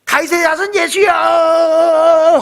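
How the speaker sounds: slow, high, drawn-out wailing shout; pleading cry. Retold or imitated. Beggar begging Jesus for mercy, wailing drawn-out shout. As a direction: stretch each word so it carries. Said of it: "소리가 더 나가라고".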